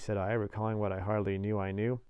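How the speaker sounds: ordinary delivery, nothing marked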